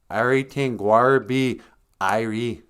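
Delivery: put-on Jamaican patois; reassuring line in a mock Jamaican accent. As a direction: imitate an actor's Jamaican accent. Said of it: "Jamaican accent".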